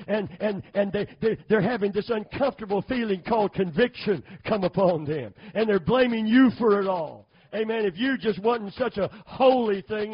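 driving, insistent sermon delivery; fervent preaching. Real, not acted. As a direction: preach it with rising force. Preacher builds up with full force.

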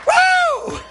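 very high, loud "woo"; exultant whoop. Real, not acted. Preacher overcome at the sermon's peak, exultant whoop. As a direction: let out a piercing revival whoop.

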